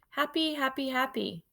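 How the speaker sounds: high, delighted chant; bubbling over with happiness; joyful. Acted. Hamster delighted by postcard.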